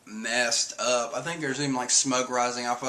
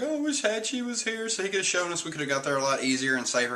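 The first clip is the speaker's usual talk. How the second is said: whiny crying; 6 semitones higher, faster than usual. Retold or imitated. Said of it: "the next page is Usopp's crying".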